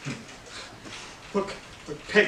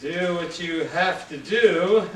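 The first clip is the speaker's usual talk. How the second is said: usual speaking voice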